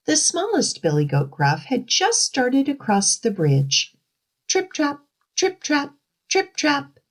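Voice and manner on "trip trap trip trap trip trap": high, light little trip-trap; tiny hoofsteps. Acted. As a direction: tap out small hooves in a high voice. Smallest goat crossing, high light hoofsteps.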